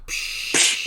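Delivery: imitated burst of steam; steam hiss. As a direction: let out a long "psh" like steam. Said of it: "like, a steam thing".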